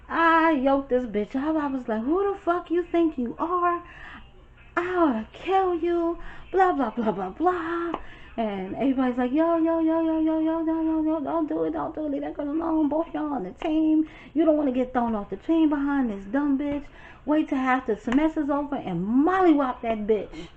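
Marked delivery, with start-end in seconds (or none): 2.0-7.9 much higher furious threat. Retold or imitated. Attacking roommate. 9.3-20.5 much higher urgent pleading. Retold or imitated. Friends holding her back.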